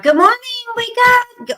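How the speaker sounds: high, loud sing-song morning greeting; bright chirpy mom waking kids; cheerful wake-up call. Retold or imitated.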